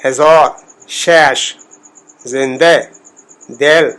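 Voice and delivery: slow citation, ہزار as "hezor", زندہ as "zende"; دل as "del", with e for short i; Persian e sound. Shown as a demonstration. Teacher listing examples of zer, Persian e sound. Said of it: "زیر کا تلفظ جو ہے".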